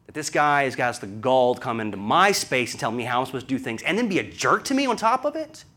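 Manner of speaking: normal talking voice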